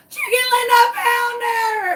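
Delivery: very high, loud, tearful joyful scream; ecstatic shriek. Retold or imitated. Owner finding her lost chicken, ecstatic shriek. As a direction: shriek with overwhelming relief. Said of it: "I was so excited".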